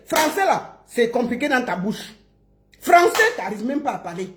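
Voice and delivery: ordinary delivery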